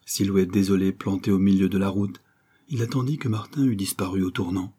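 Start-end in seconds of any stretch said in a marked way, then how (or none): none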